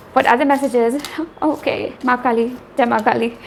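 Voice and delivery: normal talking voice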